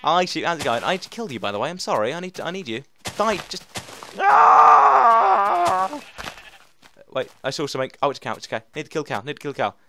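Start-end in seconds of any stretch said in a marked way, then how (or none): 4.2-6.0 high, loud yell of effort; short sharp battle yell; sudden shout. Real, not acted. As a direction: cry out as you strike. Attacking in the game.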